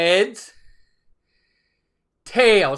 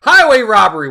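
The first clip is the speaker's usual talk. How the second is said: mock outrage; 10 dB louder than usual.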